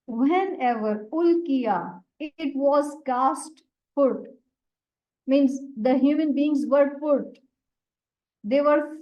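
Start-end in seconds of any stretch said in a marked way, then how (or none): none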